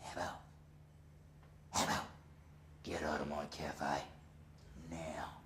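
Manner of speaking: crazy boss voice. Retold or imitated. Boss ordering the man out of his cafe, low menace. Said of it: "come out with something crazy like".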